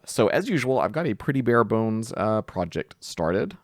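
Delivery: ordinary delivery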